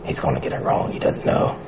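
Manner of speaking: hushed, low whisper; whispered aside. Retold or imitated. Watching the quiz show, whispering a prediction. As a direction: whisper it like a secret. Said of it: "And I was like".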